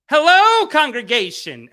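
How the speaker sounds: hammed-up grand greeting; chat greeting. Reading Neil's chat greeting, grand and loud. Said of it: "Neil too".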